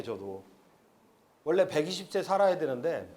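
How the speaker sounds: ordinary talk, nothing marked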